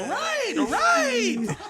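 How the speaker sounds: squeaky high, half-laughing reply; embarrassed octave jump. Retold or imitated. Punked son answering dad in front of girls, comically high squeak. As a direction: let the voice leap far up, mocking yourself. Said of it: "you ever just go a different octave".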